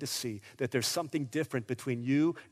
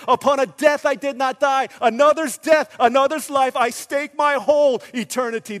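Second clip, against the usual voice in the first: high, driving, building sermon; full-force preaching; 8 semitones higher, 6 dB louder than usual. Real, not acted. Preacher proclaiming trust in Christ alone, full-force preaching. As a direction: hammer each phrase with conviction.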